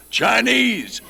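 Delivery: very high, loud called-out cue; shouted prompt. Retold or imitated. Stunt guy shouts the cue to the galloping rider, high and loud. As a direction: yell the word over the noise.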